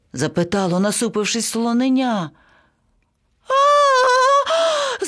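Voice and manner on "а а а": very high parrot shriek; drawn-out high scream; panicked scream. A narrator's reading. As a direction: scream in alarm. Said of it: "зарепетував папуга".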